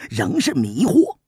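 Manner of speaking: usual speaking voice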